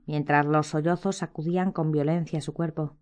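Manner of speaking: ordinary delivery, nothing marked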